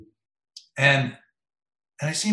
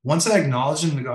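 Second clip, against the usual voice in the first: ordinary talk, nothing marked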